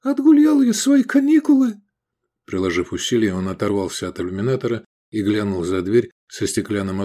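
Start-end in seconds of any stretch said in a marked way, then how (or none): 0.0-1.7 high, loud frightened cry; a boy sure he'll die; panicked thought. A narrator's reading. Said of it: "мелькнула паническая мысль".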